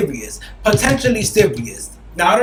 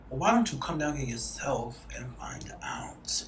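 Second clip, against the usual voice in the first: imitating him in a slow seductive whisper; suggestive whisper; 5 semitones lower, 14 dB quieter, slower than usual. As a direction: breathe it, teasing and slow. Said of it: "He said".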